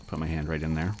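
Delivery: normal talking voice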